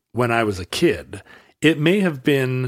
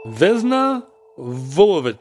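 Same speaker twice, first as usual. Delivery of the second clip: formal announcer read. Acted. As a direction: read the entry number like an archivist. Reads the show's entry over music.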